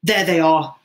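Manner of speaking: loud bitter call; loud, accusing announcement; bitter exclamation. A narrator's reading. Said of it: "the bitterness of his voice".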